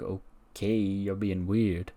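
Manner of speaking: low, quiet and drawn out; wary, unconvinced. Acted. Otis to Morgan after her excuses, slow and wary. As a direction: drag it out slowly under your breath.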